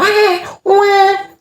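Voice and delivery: high piercing mock baby wail; baby crying imitation. Father imitating a baby crying from pain, high mock wail. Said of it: "Tapi kalau gegem tangan".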